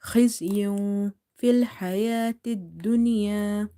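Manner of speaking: recited خزي في, tanween held as a nasal sound; ikhfa hiding. Shown as a demonstration. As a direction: hum the hidden ending of خزي into في. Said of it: "hiding here of this sound".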